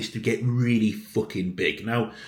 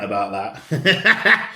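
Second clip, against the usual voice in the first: amused and laughing over the words; 2 semitones lower, 5 dB louder, much slower than usual. Real, not acted. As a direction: laugh it out after the rant. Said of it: "I've had me little rant".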